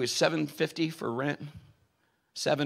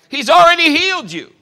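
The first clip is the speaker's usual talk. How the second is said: "already" cried out much higher and louder, peak of the sermon; climactic preaching cry; 13 semitones higher, 16 dB louder than usual. Acted. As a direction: hit "already" hard and high, near a shout. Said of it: "repeats line, second time climactic".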